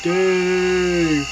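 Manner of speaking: a distant call of Dave, drawn out; a faint, far-off shout of a name. Retold or imitated. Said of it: "sounded like".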